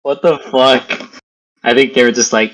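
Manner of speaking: ordinary talk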